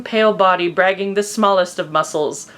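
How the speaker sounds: normal talking voice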